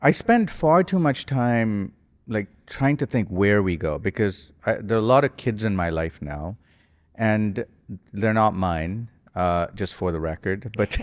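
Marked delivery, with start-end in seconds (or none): none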